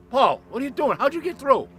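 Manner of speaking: high-pitched, astonished questions; imitated surprise. Surprised reaction to unexpected call.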